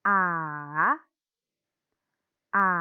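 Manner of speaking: A dipping down then rising back up; dipping third tone. Shown as a demonstration. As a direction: swing A down and then up. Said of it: "Turun lalu naik".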